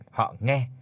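ordinary delivery

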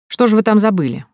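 подозрительный вопрос, повыше и громче; ищи подвох в каждом слове; с подозрением. A narrator's reading. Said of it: "явно ища подвох". (отец допрашивает гостя с явным подозрением)

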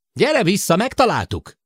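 high, loud call. A narrator's reading. Sid calling Jill back, they found something. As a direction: shout it down the corridor.

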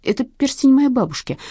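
ordinary delivery

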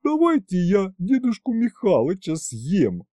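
high, put-on dragoness voice; eager dragoness. A narrator's reading. She-dragon offering to eat grandfather, high-voiced. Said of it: "предложила Горыня".

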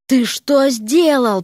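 slow, tense hiss; hissed reproach. A narrator's reading. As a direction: hiss it slowly in outrage. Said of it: "прошипела Вася". (возмущённо прошипеть)

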